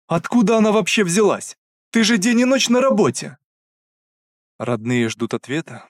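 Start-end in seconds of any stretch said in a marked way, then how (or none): 0.1-3.4 high, loud anguished questions; distraught demand. Acted. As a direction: cry out in disbelief. Mother demands answers. (отчаянные вопросы)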